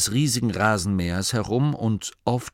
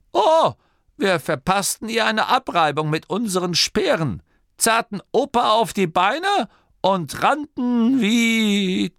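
high, loud, boisterous storyteller; excited old man; 12 semitones higher, 4 dB louder than usual. A narrator's reading. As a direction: blurt it out high and eagerly. Old Torrid continues his tale. (aufgeregter Alter, hohe Stimme)

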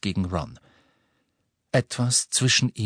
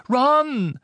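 high, loud cry of the name; sharp call; 5 dB louder than usual. A narrator's reading. Hermine stepping between the quarrelling boys, sharp high call. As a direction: call him to order loudly.

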